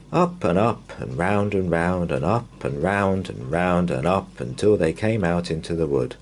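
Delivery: usual speaking voice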